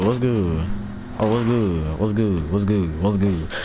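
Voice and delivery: ordinary talk, nothing marked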